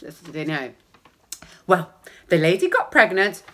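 usual speaking voice

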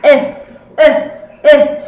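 short separate "إه", an eh vowel; "إه" repeated as short eh beats; short E sound. Shown as a demonstration. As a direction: punch out "إه" as a brief eh. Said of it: "ساعات بطول في ال E".

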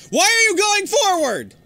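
panicked shriek. Real, not acted. Alarmed at partner's move.

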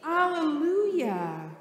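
high, loud, slow jubilant cry; joyful hallelujah. Shown as a demonstration. Pastor teaching children an excited church word, loud and high. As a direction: ring it out in praise. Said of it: "words we use when we're so excited".

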